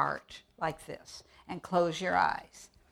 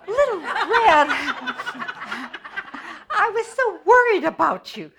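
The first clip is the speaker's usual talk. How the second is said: much higher, loud quavery granny; old granny voice; 12 semitones higher, 11 dB louder than usual. Pretend. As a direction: greet them as a fussing granny. Granny frets.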